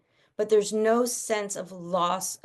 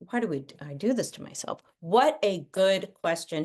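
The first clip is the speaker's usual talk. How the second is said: normal talking voice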